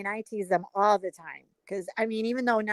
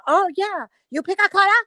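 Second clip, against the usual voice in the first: put-on raised, louder Chinese-accented question; mock Chinese accent; 6 semitones higher, 5 dB louder than usual. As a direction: imitate a nail tech's Chinese accent. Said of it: "she does a perfect Chinese person".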